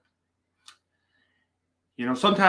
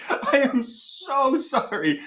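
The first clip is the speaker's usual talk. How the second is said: slow, higher, giggling sorry; laughing apology; 11 semitones higher, much slower than usual. Real, not acted. Host still laughing, apologising to chat for the joke. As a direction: squeeze the apology out through laughter.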